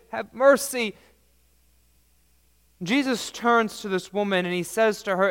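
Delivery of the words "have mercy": crying out. A narrator's reading. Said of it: "the woman was still crying out to them".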